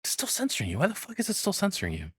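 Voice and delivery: normal talking voice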